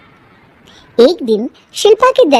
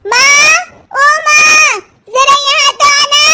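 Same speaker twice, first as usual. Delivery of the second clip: much higher, louder sing-song call; 14 semitones higher, 4 dB louder, slower than usual. Acted. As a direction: call mother from across the house. Daughter calling mother from afar.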